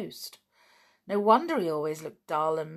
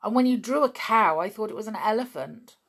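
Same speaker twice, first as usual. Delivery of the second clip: louder, higher, mocking a sister; scornful; 4 semitones higher, 7 dB louder than usual. A narrator's reading. Dick mocking Anne's drawing, scornful. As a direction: scoff at her drawing. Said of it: "said Dick scornfully".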